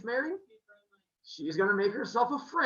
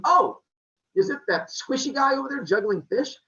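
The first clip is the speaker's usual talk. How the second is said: mock eager guessing; 7 semitones higher, 7 dB louder than usual. Aphrodite guessing her groom eagerly.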